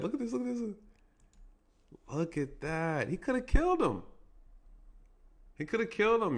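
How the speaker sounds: high-pitched, urgent amazement; alarmed disbelief. Real, not acted. Officer showing viewers he could have killed him, incredulous. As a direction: urge them to look, pitch shooting up. Urging viewers to see the danger.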